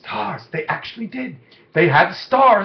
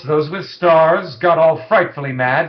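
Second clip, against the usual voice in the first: ordinary delivery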